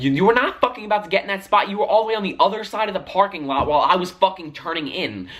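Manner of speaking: high, heated mock argument; retelling a parking-lot fight, heated and high; retold angry retort. Arguing with driver in parking lot.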